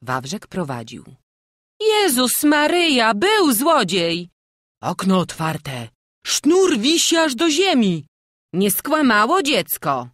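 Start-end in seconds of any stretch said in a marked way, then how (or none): 1.8-10.1 loud, high alarmed exclamations; crowd shouting. A narrator's reading. Townspeople discover the open church window, shouting in alarm. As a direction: cry out one voice after another. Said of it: "krzyczeli ludzie jedno przez drugie".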